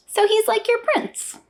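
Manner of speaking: put-on high, slow, wide-eyed child's voice; curious little girl. Mom voicing her small daughter asking the uncle, high and curious. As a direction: ask it like a tiny curious girl.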